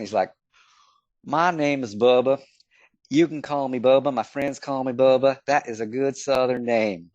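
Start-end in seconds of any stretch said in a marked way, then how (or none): 1.2-7.1 put-on thick Southern accent. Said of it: "in a very thick accent".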